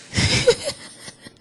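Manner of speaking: high burst of laughter. Real, not acted. Host joking about being a young grandmother, amused laugh. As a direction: laugh at your own joke.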